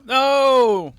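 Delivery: sudden loud high cry of disbelief; shocked no. Real, not acted. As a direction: blurt it out in shock. Reacting to copyright strike news.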